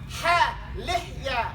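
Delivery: Ha and lihya slow with a breathy throat h. Shown as a demonstration. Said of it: "The ha of the throat".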